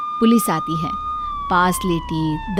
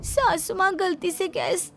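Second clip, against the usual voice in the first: very high, tearful whimpering voice; wailing young daughter-in-law; pained whimper; 12 semitones higher than usual. Acted. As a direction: plead and explain through the pain. Burnt bride explains while crying.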